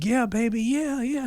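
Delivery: high-pitched reassurance, holding back tears; retold tearful reply. Father answering his daughter while crying, high and tearful. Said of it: "I'm, like, crying".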